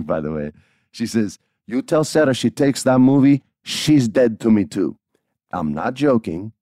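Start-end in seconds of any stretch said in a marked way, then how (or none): 1.7-5.0 upset grandmother. Retold or imitated. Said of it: "she's pretty upset".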